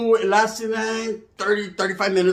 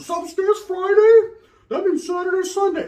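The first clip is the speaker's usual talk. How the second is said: mock girly voice; 12 semitones higher than usual. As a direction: squeal it like an excited date. Mocking her excited weekend talk.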